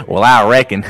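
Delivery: hammed-up Southern US cowboy voice; Southern accent. Answering red-blooded American jab, mock Southern US accent. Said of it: "I do that accent all the time".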